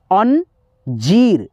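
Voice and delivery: अंजीर broken as an, then long jeer; slowly part अं from a held जीर; stretched long ee. Shown as a demonstration. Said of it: "खींच करके उच्चारण".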